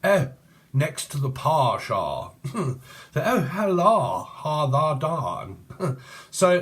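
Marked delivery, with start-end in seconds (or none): 0.0-2.3 slow, stretched posh English vowels; very posh accent. Acted. Posh person answering about parking, very posh drawl. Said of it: "this person could speak very posh". 3.2-5.6 high, loud, stretched posh vowels; hammed-up posh English nonsense; very posh accent. Parodying a very posh person's hello, exaggerated posh accent. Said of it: "this person could speak very posh".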